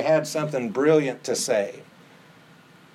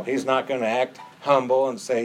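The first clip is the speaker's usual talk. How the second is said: ordinary delivery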